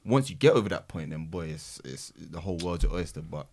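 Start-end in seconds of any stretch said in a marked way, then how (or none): none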